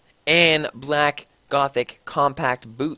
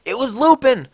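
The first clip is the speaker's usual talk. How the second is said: higher, louder hammed-up yell; 10 semitones higher, 4 dB louder than usual. A narrator's reading. Ebony yelling at Draco through the door, louder and higher. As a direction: bellow it at the door. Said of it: "I yelled".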